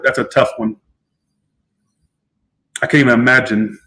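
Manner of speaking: normal talking voice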